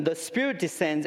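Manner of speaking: normal talking voice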